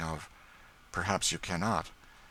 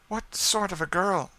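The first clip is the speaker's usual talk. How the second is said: much higher, louder eager ask; eager question; 13 semitones higher, 5 dB louder than usual. A narrator's reading. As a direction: lean in, hungry to know. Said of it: "said Madame Desault eagerly".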